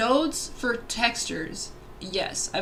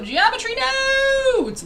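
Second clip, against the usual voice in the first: excited; 8 dB louder than usual. Real, not acted. Hyped announcing the topic.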